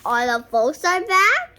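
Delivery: high, loud eager question; loud excited question. Real, not acted. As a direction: ask full of excitement.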